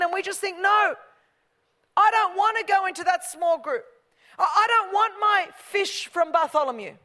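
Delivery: high, loud, driving sermon delivery; full-force preaching. Acted. Pastor rousing the congregation, full-force preaching. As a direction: preach it out to the back row.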